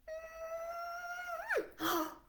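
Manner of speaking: huge surprised gasp; big excited gasp. Acted. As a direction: gasp in wonder at the page. Opening the book.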